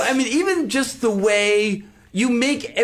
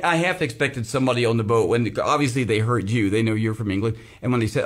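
ordinary talk

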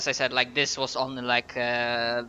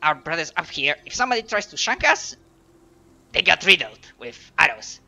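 raised, scolding goblin; 3 semitones higher, 3 dB louder than usual. Acted. Goblin reassuring a bored fellow guard, scolding goblin voice. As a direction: goblin boasting with pitch jumping up. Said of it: "doing their voice".

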